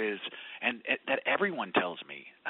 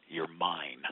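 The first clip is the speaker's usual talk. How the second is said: tender divine voice; 7 semitones lower, 3 dB quieter than usual. Retold or imitated. God's tender voice in retelling.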